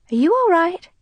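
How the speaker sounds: mother puzzled by her daughter; worried mother. Acted. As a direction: ask with motherly worry. Mother worried at odd behaviour.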